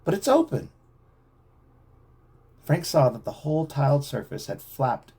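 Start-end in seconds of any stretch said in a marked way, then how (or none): 0.0-0.7 surprised. A narrator's reading. Chet's surprise at hole.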